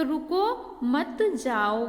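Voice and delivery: slow रुको, break, drawn-out मत जाओ; मत joined to जाओ, stretched after रुको; gap after रुको. Shown as a demonstration. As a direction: leave a gap before मत जाओ. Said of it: "यहाँ पे गैप हो रहा है ना".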